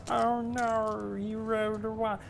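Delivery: a high, lilting mock Australian "oh no"; Aussie accent again. Mocking his opponent's bad roll in a playful Australian accent. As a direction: lift it up into a playful Aussie whine. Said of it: "Why are we doing Aussie accents?".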